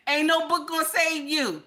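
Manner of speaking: usual speaking voice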